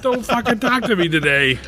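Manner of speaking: high, snappy mock grump; mock grumpy warning. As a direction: warn them off, half joking. Acting out a mood badge.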